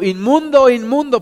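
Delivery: high, loud cry, fading on the second word; shouting. A narrator's reading. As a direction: call out a warning as he walks. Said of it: "ir gritando".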